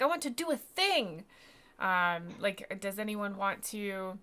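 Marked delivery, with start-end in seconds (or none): 0.0-1.2 eager player. Retold or imitated. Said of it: "so you can be like".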